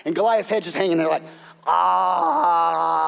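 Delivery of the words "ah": loud comic ah; mock death cry. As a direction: ham up a dying groan. Severed head hanging.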